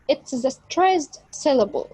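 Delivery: normal talking voice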